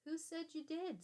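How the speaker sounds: sharp, high snap back; snapped. Acted. Old man snapping at the accusing boy, sharp. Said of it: "snapped the man".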